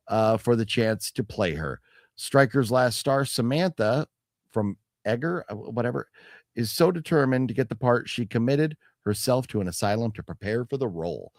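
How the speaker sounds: usual speaking voice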